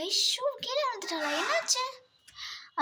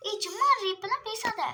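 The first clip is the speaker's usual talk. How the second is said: ordinary delivery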